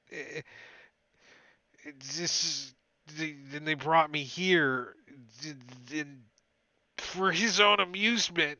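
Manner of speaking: a cold frightened prisoner stumbling over his words; shivering prisoner. Acted. Prisoner continues his story.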